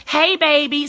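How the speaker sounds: high, loud, drawn-out whine; whining. Real, not acted. Wife calling to her husband, whining. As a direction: whine for attention. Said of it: "That's how I whine at my husband".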